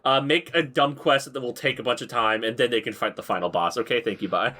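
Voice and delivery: a lightly voiced boss, low and offhand; lazy CEO voice. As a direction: brush off the dev team like a tired CEO. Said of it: "the C E O calling up his dev team".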